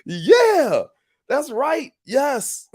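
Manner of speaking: high, loud whoa of amazement; excited disbelief. Retold or imitated. Nephew hearing his uncle refused to die, thrilled and high. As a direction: squeal it out in shocked delight. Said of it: "I was like".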